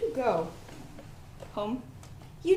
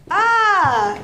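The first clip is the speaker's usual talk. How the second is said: startled cry; 7 semitones higher, 8 dB louder than usual. Acted. Startled by something gross.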